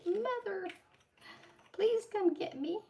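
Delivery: high, whiny pleading dog voice; voicing the dog. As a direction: beg like a pup stuck in a chair. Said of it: "looking at me like".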